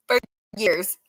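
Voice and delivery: laughing at the absurdity. Real, not acted. Streamer repeats to chat, laughing. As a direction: echo it through a real laugh.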